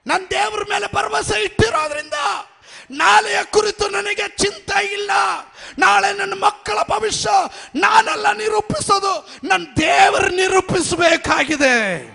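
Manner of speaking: high, loud, driving sermon shout; full-force preaching. Acted. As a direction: preach with everything you have. (ಪೂರ್ಣ ಶಕ್ತಿಯ ಜೋರು ಪ್ರಸಂಗ)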